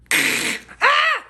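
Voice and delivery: a very high, loud hammed-up scream; mock shriek when tased. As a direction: squeal like a cartoon jolt of electricity. Woman being tased.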